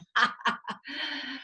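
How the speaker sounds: high, soft triumphant chuckle; gloating laugh. Acted. Leonardo after besting Michelangelo, gloating laugh. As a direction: laugh in self-satisfied triumph.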